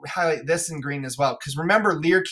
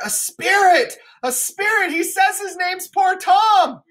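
much higher, louder panicked cry; terrified fool shout; 13 semitones higher, 4 dB louder than usual. Acted. Fool shouting to Lear about the spirit, much higher. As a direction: yell that there's a ghost.

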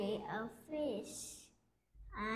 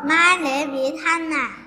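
loud; 4 semitones higher, 16 dB louder than usual. Real, not acted. Child answers at full volume.

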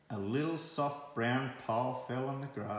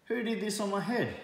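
much higher, eager question; excited; 11 semitones higher, 4 dB louder than usual. A narrator's reading. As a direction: burst out hopeful, finally onto something. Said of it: "he asked excitedly".

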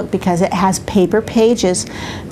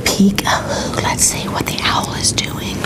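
whisper; 3 semitones lower, 5 dB quieter than usual. Acted. Grandma reading peekaboo book to baby.

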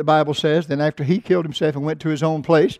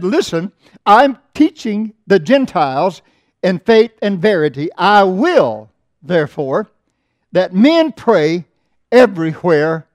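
loud, slow, ringing pulpit reading; preaching the text at full voice; scripture reading; 2 semitones higher, 6 dB louder, slower than usual. Acted. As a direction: proclaim the verse to the congregation.

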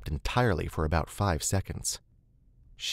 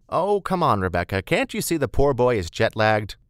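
higher, louder chiding woman's voice; motherly Beth, teasing; 5 semitones higher, 5 dB louder than usual. Acted. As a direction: scold playfully on someone's behalf.

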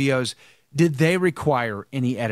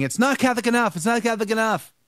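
mocking critics; 8 semitones higher, faster than usual. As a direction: parrot the critics with a higher, whiny, mocking lilt.

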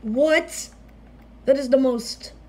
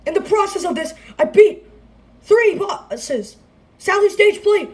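high loud elated listing; excited squeal; 7 semitones higher, 3 dB louder than usual. Real, not acted. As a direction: rattle off wins in triumph. Listing beaten bosses, elated.

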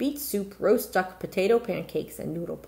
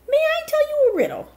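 bright eager; 7 dB louder than usual. Acted. Razel changes subject.

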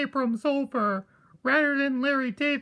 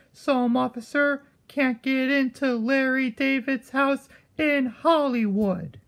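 ordinary talk

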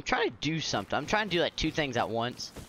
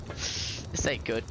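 muttered dread; 6 semitones lower, 6 dB quieter, much slower than usual. Real, not acted. Grim under breath.

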